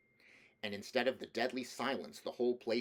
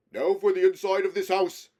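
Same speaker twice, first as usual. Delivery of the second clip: high, much louder rallying call; rally the troops loudly; rousing command; 10 semitones higher, 14 dB louder than usual. A narrator's reading. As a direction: Aslan rousing the freed creatures to search. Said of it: "said Aslan".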